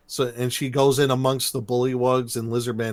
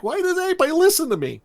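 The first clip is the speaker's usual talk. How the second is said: very high, rushed mock wail; mock screaming; faster than usual. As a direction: imitate a panicky complainer, squealing fast. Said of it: "like, yelling and screaming".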